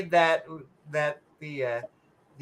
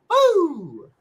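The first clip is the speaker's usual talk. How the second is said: mock boo; 9 semitones higher, 5 dB louder than usual. Mock booing the joke.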